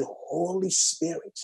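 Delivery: soft, slow pleading prayer; quietly ask, almost breathing it; soft prayer fading to a whisper; hushed prayer. Retold or imitated. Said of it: "He said".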